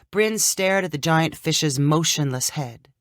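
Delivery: usual speaking voice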